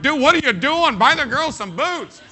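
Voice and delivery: high, loud mock scolding; loud laughing grandpa protest; exasperated shout. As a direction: grandpa pleading loudly with the dad. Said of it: "and I'm like".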